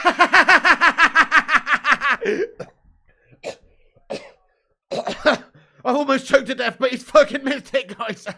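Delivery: high and loud, breaking into laughter; laughing through words. Real, not acted. Streamer telling chat the penalty was missed, laughing. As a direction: gasp it out between laughs.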